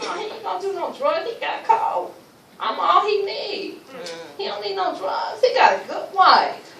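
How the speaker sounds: high, loud, desperate insisting; pleading denial. Retold or imitated. Wife insisting to herself her husband needs no drugs, high and crying. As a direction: cry out in denial, near tears.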